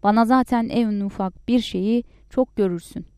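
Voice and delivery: usual speaking voice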